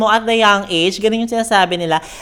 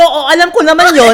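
sassy retort; 6 semitones higher, 8 dB louder than usual. Retold or imitated. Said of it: "Sabi ko".

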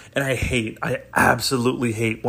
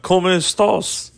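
higher, fuller mock Russian-accented Spanish; 6 semitones higher than usual. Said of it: "speak with a Russian accent on top of it".

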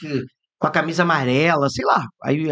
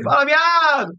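mock yell; 9 semitones higher, 4 dB louder, slower than usual. People calling out to Raul.

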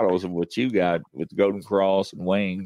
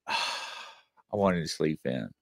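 mock grumbling; 5 semitones lower, 8 dB quieter, slower than usual. Groggy reluctance at early appointment.

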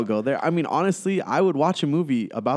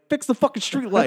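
exasperated demand; 11 semitones higher than usual. Acted. Griping about an unresolved movie plot.

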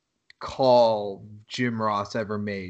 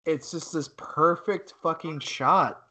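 normal talking voice

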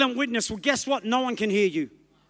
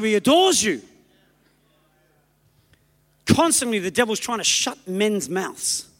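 normal talking voice

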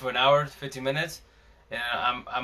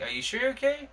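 much higher, lifted question; concerned checking; 11 semitones higher, 3 dB quieter than usual. Retold or imitated. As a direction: imitate a worried check-in, pitched well up. Retells neighbour checking on him.

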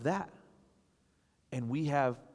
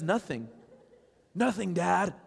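higher, louder imitation of a child; mock insistent denial; 7 semitones higher, 5 dB louder than usual. Daughter fending off dad, retold insistent denial. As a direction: push dad away with a high mock protest.

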